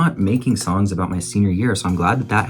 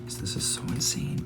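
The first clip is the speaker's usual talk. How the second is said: whisper; 3 semitones higher, 11 dB quieter than usual. Real, not acted. First take of repeated line.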